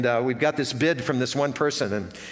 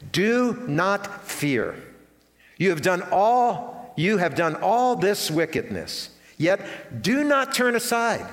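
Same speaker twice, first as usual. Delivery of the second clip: slow solemn reading; 4 semitones higher, much slower than usual. Shown as a demonstration. Reading Samuel's words aloud.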